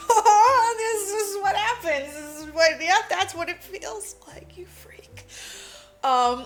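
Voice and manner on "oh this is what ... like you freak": mocking inner voice.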